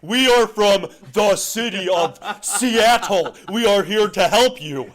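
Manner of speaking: high, loud, slow stiff announcement; mock Seattle official. Seattle city helper addressing locals in a stiff official voice. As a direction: announce help like a pompous bureaucrat.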